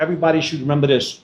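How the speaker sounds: ordinary talk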